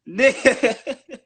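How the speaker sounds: loud burst of laughter; laughing. Real, not acted. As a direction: crack up mid-word.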